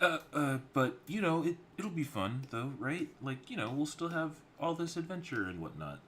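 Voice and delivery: put-on high, loud, excited kid-sister voice; eager younger sister. Younger sister pleading with her older sister, high and eager. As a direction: pitch it way up, bubbling with hope.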